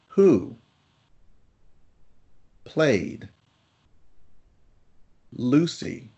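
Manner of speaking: long pauses between each word; slow quiz read, word by word; one word at a time. Shown as a demonstration. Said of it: "I will ask these questions one word at a time".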